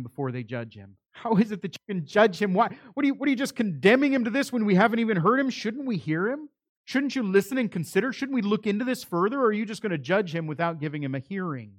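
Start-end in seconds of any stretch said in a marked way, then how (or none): 1.1-10.8 higher, louder, fast protest; indignant challenge. Retold or imitated. Nicodemus confronting the religious leaders, indignant and loud. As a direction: demand a fair hearing, fast and raised. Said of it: "Nicodemus is looking to the religious leaders".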